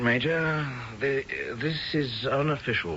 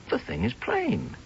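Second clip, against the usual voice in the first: ordinary talk, nothing marked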